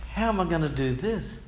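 mock bewildered self. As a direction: ask it helplessly. Retelling his own doubt.

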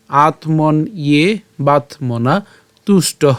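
"আত্মন ইয়ে বাতমনা তুষ্ট" very slow, piece by piece; broken into syllables. Shown as a demonstration. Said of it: "আমরা করছি এভাবে". ("আত্মন ইয়ে বাতমনা তুষ্ট" ধীরে ভেঙে ভেঙে)

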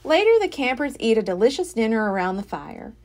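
plain reading voice

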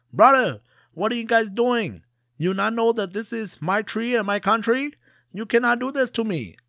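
high, loud pleading yell from the treetop; panicked yell. Acted. Merchant stuck in tree yells at the servants chopping, loud panicked shout. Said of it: "all he did was yell at them".

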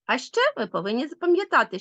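ordinary talk, nothing marked

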